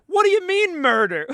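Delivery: high, loud mock indignation; quoting a meme video, high loud mock indignation. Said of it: "That's like the".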